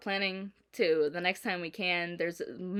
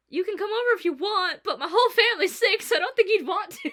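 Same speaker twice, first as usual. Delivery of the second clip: higher and louder, giggling apology; laughing warning; 11 semitones higher, 7 dB louder than usual. Retold or imitated. Warning a friend the family is sick, laughing. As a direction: laugh while warning her off. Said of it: "And I was like".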